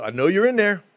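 shouting into the locked house; retold yell at door. Pounding on a sponsee's door, a loud retold yell. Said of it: "Started getting awful loud".

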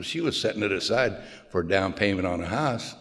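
normal talking voice

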